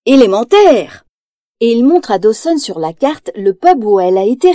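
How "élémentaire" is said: louder, pleased cry of discovery; triumphant exclamation. Acted. Detective Basil solving the clue, triumphant exclamation. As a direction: announce the answer with a flourish. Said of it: "il s'exclame".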